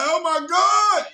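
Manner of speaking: high, slow, stunned oh my God; shocked gasp. Retold or imitated. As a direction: gasp it out in disbelief. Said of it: "I was like".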